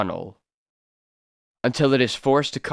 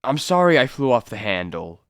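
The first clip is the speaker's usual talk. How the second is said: ordinary talk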